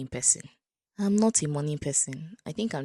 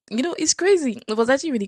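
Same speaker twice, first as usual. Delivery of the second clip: excited amazement; 10 semitones higher, 6 dB louder, faster than usual. Real, not acted. Amazed exclamation.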